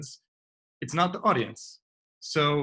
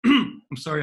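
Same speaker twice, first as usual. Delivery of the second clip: normal talking voice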